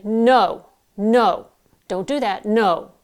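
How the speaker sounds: projected no. Shown as a demonstration. Coach demonstrates projecting at a clawing kitten. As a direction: project it like stopping a kitten at the curtains. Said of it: "That's projection".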